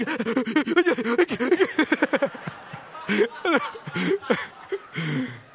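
imitated high, stuttering sobbing gasps; hyperventilating cry. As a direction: mimic a child's hiccuping, breathless crying fit. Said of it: "You know that type of cry".

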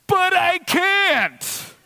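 very high, loud, drawn-out protest; mock child's wail. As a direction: wail back like the crying four-year-old. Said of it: "You ever feel like this".

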